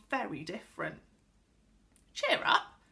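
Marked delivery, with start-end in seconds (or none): 2.1-2.8 high, loud chivvying call; jolly her out of it; encouraging. A narrator's reading. Beth encourages a tearful Connie.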